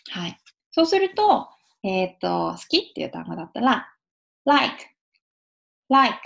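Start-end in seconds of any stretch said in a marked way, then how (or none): 4.4-6.2 "like" with tongue dropping from upper teeth; English L. Shown as a demonstration. Teacher demonstrating the word like, clear initial L. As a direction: start "like" on a clear L, tongue coming down. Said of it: "ここから上から下に降りる".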